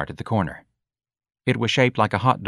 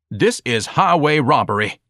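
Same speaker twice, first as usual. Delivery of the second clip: indignant boom, raised pitch; thundered; 6 semitones higher, 4 dB louder than usual. A narrator's reading. Pompous son protesting the bill, thundered. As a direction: declaim it in pompous outrage. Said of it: "Ignatius thundered".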